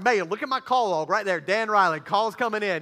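retold excited insistence, loud; loud, excited proof-giving; freaking out. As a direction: urge her to look, loud and worked up. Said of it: "we're, like, freaking out".

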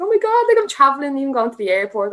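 high and loud, gushing over the trip; excited quote. Retold or imitated. Athlete recalling her first trip abroad, high excited gush. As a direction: squeal it like a thrilled kid off to the airport.

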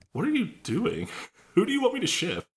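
high-pitched baffled plea; squeaky-high exasperation, played for laughs; mock bewildered. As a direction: plead it high, in mock confusion. Acted viewer reaction to the film.

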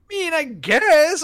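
high, slow, unsure shrug; sheepish concession. Retold or imitated. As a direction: give in weakly, drawn out. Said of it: "I was just like".

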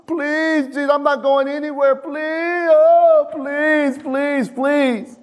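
high-pitched, drawn-out begging; pleading whine. Acted. Desperate father begging Jesus to come, high mock whine. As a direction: whine and plead, stretching out each please. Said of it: "stayed there and whined".

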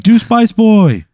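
high, slow, drawn-out demand; a fan begging for the act. Retold or imitated. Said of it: "And they're like".

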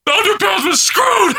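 high, slow heckler's yell; mock outraged shout. Host plays an Underpants fan booing, mock outraged shout. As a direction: shout it like a wronged fan in the crowd.

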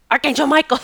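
commanding call. Retold or imitated. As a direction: summon "Archangel Michael" like an order. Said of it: "I'm screaming".

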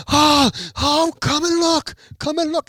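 high, loud amazed yelling; excited screaming. Retold or imitated. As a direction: imitate a man screaming for everyone to come see. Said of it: "he starts screaming".

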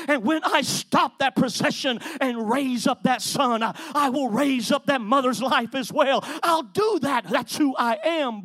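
loud, rising preacher's cadence; full-force preaching. Acted. Preacher proclaiming Jesus' miracles to the church, loud. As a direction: build it like a pulpit climax.

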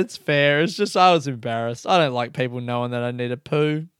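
usual speaking voice

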